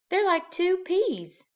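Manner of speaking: high, slow wifely voice; Mrs Popper's voice. A narrator's reading. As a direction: read her line up high and slow. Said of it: "said Missus Popper".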